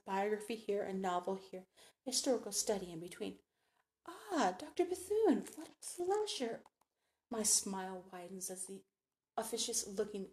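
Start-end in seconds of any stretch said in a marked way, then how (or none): 4.1-6.6 high, drawn-out gushing greeting; effusive society matron. A narrator's reading. Society hostess greets Doctor Bethune at the luncheon, effusively.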